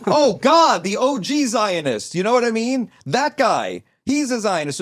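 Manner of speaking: high-pitched, emphatic outburst; excited, carried-away shouting; yelling. Real, not acted. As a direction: yell it out in sudden recognition. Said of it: "Don't yell".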